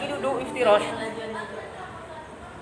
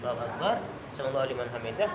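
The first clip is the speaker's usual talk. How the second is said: quiet takbir demo; 12 semitones lower, 12 dB quieter, faster than usual. Shown as a demonstration. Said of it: "Ini masih boleh".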